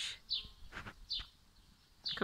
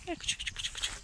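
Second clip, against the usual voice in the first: very soft voiceless coaxing call; soft puppy call; 8 dB quieter, faster than usual. Real, not acted. Farmer quietly lures the shy puppy, hushed calling. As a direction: barely breathe the call to lure the pup.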